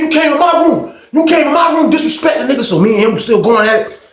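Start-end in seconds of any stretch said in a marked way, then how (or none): 0.0-2.6 angry shout. Retold or imitated. Rival in the fight, accusing him of entering his room: angry shout.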